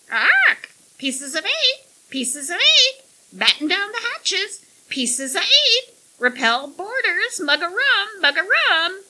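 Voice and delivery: high, loud squawking parrot chatter; shrill bird repeating its phrases. A narrator's reading. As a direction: screech every phrase like a pirate's parrot.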